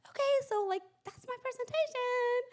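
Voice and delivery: high, strained, tentative wrap-up; awkwardly nervous. Retold or imitated. Speaker ends her improvised pitch to the board, awkwardly nervous. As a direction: imitate a nervous presenter squeaking to a close.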